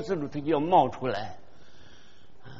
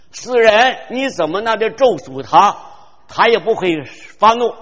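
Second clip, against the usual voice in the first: 咒诅 hit louder and higher; louder, higher preaching on 咒诅他; forceful preaching; 5 semitones higher, 8 dB louder than usual. Shown as a demonstration. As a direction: preach the curse line with force. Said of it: "contrast praise and curse".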